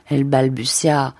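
usual speaking voice

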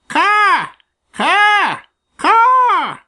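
high, loud caws like a crow; crow cawing. Acted. Crow shouting to stir the farm boy, loud high caws. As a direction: shout each caw as a crow. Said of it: "shouted Blacky".

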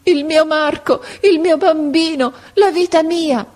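high, anguished outcry in short bursts; impassioned high wail of longing; exalted cry. A narrator's reading. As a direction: cry out for your child from a deathbed. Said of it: "Ed esaltandosi tutto a un tratto gridò".

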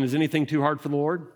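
fast, plain asking of the line; preacher putting the question to the listeners; quick direct question. Shown as a demonstration. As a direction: toss the question straight at them, quickly. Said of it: "let me ask you a question".

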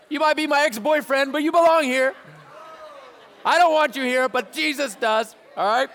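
high, loud, joking shout at a friend; playful shout. Shown as a demonstration. Pastor acting out a student yelling at the kid behind, playful shout. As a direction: yell it half-teasing across the room. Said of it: "Be like".

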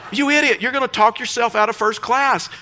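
high, acted exasperation; exasperated scolding. Pastor warning Gary about losing first class, exasperated. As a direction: scold a foolish friend, pitched well up.

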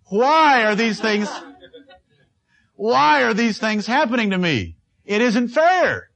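high, slow self-pitying wail; whiny complaint. Acted. Churchgoer complaining to the pastor, high and whining. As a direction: moan it like someone sure life is unfair.

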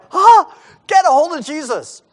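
high, loud alarmed cry; panicked yell. Retold or imitated. Terrified disciples yelling for Jesus in the storm, high panicked shout. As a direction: shout it like men in a sinking boat. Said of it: "everybody is like".

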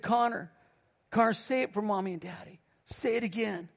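soft imitated mother to her child; tender, softened retelling of a parent's request; gentle coaxing. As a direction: coax a small child quietly. Mother coaxing little boy, retold.

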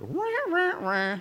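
high whiny mock wah wah; hammed-up whiny wah wah. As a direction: whine it like a sad trombone. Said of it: "I was like".

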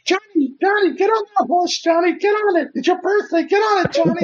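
very high, shrieky mock holler; high-pitched old ladies. Old ladies behind her egging Johnny on, high-pitched. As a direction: squeal it like a table of old ladies. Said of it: "that high pitch".

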